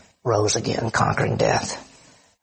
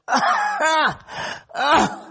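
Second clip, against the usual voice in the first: horse-like groan; 12 semitones higher, much slower than usual. Retold or imitated. Preacher shows how Jesus groaned deeply moved, horse-like groan. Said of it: "almost the sound that a horse makes".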